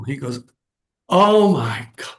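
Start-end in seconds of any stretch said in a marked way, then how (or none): 1.1-2.1 slow, louder, higher awed gasp of words; a touch of amazed disbelief; stunned exclaim. Retold or imitated. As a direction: retell the dean's shock, drawn out. Dean stunned by famous uncle's name.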